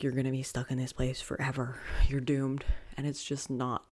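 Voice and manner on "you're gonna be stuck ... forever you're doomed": hushed whisper of a gloomy inner voice; whispered doom. Retold or imitated. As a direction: breathe "You're gonna be stuck in this place forever" as a whisper. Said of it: "my mind is like".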